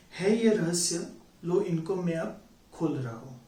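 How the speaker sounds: dramatic Hindi verse reading with long pauses; measured, theatrical poetry delivery; poem recitation. Acted. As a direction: recite it line by line, swelling and falling away.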